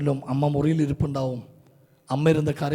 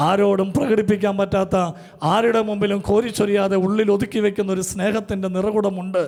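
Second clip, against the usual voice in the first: climbing into fervent preaching, louder and higher; building preaching; 5 semitones higher, 4 dB louder than usual. Acted. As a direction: build each line higher until ഉള്ളിലും rings out. Sermon builds to a fervent peak. (ആവേശത്തോടെ ഉയരുന്ന പ്രസംഗം)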